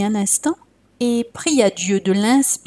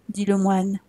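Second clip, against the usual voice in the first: usual speaking voice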